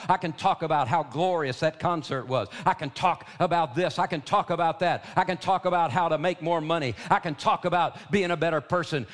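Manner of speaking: loud, high, driving sermon build; full-force preaching. Acted. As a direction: preach it hard, rising to a cry.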